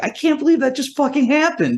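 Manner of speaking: very high-pitched retold disbelief; incredulous. Guest outside after being thrown out, high incredulous voice. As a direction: squeal it in acted amazement.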